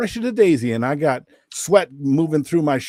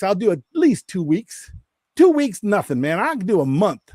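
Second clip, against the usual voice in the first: raised, boastful one-upping; talking smack; 6 semitones higher than usual. Retold or imitated. Talking smack to a friend on video, cocky re-enactment. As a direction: brag higher and higher, egging yourself on. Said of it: "I'm just talking smack to him".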